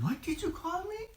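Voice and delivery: very high, strange creature voice, startled; high creature voice asking his name; Gollum voice. Acted. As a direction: squeak it out like a wary Gollum. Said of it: "And he said".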